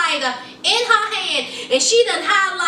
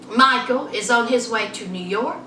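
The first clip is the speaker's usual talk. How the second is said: ordinary talk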